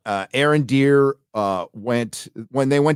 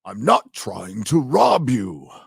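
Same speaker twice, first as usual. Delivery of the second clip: slow booming wizard shout, higher; mock wizard; 4 semitones higher, slower than usual. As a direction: parody a grand old wizard calling out. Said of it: "what does that remind you of?".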